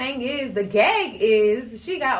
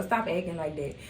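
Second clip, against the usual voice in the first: ordinary talk, nothing marked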